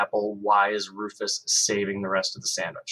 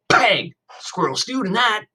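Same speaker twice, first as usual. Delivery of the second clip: high, loud mock bang from a small boy; little kid copying; 11 semitones higher, 5 dB louder, slower than usual. A narrator's reading. Little brother imitating the gun, high playful bang. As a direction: copy big brother's bang. Cody copies his brother.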